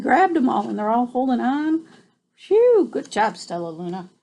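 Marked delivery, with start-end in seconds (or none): 2.3-2.9 loud high sigh of relief; relieved phew. Real, not acted. Reader relieved the birds are safe, loud high phew. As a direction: blow out relief.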